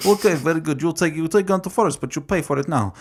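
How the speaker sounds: lightly put-on Russian accent; a hint of Russian gangster. Boris voiced in retold scene.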